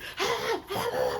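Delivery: loud high laugh at him; just crack up; bursting laugh. Real, not acted. Laughing at his reaction.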